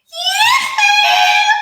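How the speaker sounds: very high, loud yoo-hoo; calling shout. A narrator's reading. Witch calling out loudly to a cow. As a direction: sing it out across the meadow. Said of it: "she shouted".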